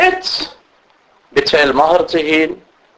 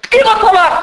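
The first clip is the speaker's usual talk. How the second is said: much higher insistent cry, hammed-up; mock demanding wife; 13 semitones higher, faster than usual. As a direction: act the wife insisting on divorce.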